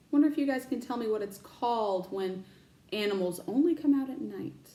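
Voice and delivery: plain reading voice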